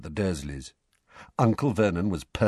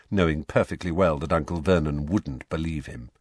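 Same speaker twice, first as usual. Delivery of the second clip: ordinary delivery, nothing marked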